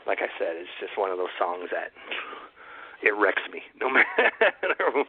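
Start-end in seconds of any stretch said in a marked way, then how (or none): 3.8-5.1 a laugh breaking into the words, higher, drawn out slow; ruefully laughing at herself; laughing through words. Real, not acted. Admitting a sad song wrecks her, slowly laughing.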